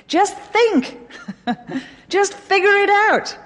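high-pitched urging through chuckles; laughing exhortation. Acted. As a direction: laugh as you urge them on, pitched high. Playfully urging students, laughing.

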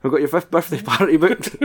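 teasing, laughing jab; laughing mock parent. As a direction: say it through a laugh. Joking to old actor as son.

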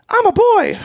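very high, squeaky retort through a laugh; high-voiced teenage boy. Teen at drive-thru corrects dad, high voice. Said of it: "he's got a high voice".

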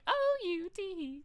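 high, slow put-on model's voice; car show model. Model answering men at a car convention, high put-on voice. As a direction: draw it out way up high. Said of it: "And her always going".

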